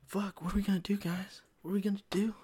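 hushed joking fright, the last line almost whispered; mock panic. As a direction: fake alarm to the listeners, quiet and high. Realises they skipped the recommendation.

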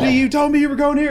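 high, loud phone tirade; acted angry dad. Retelling dad phoning his son about changed plans, acted fury. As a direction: rage at a kid in hammed-up indignation. Acting furious dad's phone call.

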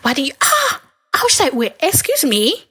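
ordinary talk, nothing marked

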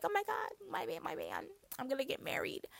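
high giddy squeal; excited squeal. Retold or imitated. Whitley imagined excited.

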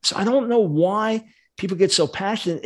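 ordinary talk

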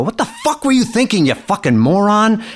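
high-pitched re-enacted angry tirade; upset outburst. Father opens car door on the boy who dented it, upset outburst. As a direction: blow up at him, pitch jumping way up. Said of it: "I was a little upset".

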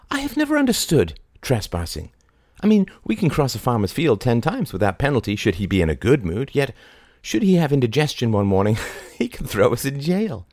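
high, fast, whimsical musing; lightly voiced odd Mary. A narrator's reading. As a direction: ramble playfully like a mad woman.

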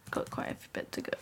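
unvoiced whisper aside. Real, not acted. Friend whispers an aside about the long story. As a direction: whisper it like a secret.

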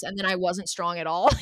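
normal talking voice

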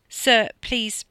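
anxious, deferential protest; pleading. A narrator's reading. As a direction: plead politely to be heard. Driver pleads, gets cut off.

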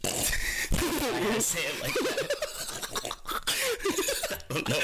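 high giggling laughter; laughing. Real, not acted. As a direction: laugh through the words. Laughing at own phrasing.

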